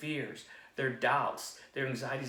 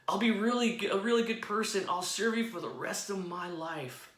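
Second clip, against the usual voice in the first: pleading; 6 semitones higher than usual. Acted. Jack keeps promising God.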